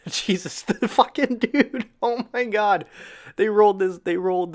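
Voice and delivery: can't believe what he just saw; real excitement. Real, not acted. Fan reacting to the match teaser, high amazed outburst.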